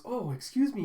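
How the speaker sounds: high, lightly put-on courteous call; acted polite opener; polite stranger. Disguised father approaching boys at cards, lightly put-on politeness.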